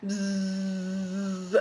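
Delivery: drawn-out buzzing noise, slowed right down; a long droning buzz; imitated clipper buzz. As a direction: mimic the hum of hair clippers. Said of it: "I just hear".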